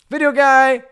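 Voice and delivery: high, loud hype call. Retold or imitated. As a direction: holler it like a party catchphrase. Calling out his pickup line.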